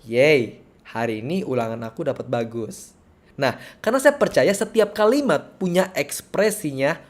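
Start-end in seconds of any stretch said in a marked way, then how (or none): 0.0-2.9 deadpan, unenthusiastic cheer; unexcited reaction. Shown as a demonstration. Showing how nobody reacts to good grades, unexcited. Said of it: "nggak mungkin kan seperti ini".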